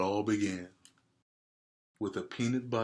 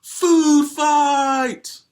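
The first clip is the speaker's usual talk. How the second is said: very high, very loud shout; yelled; 16 dB louder than usual. Acted. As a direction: scream it to start the chaos. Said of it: "Somebody yelled".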